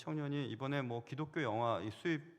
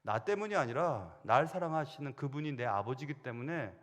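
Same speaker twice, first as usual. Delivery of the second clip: usual speaking voice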